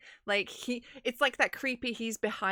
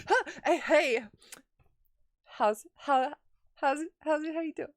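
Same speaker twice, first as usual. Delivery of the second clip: higher, louder flustered stammer; caught off guard; 7 semitones higher, 5 dB louder than usual. Retold or imitated. Caught sneaking letters back, Miria stammers at Horatio flustered. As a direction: stumble over the words like you've been caught.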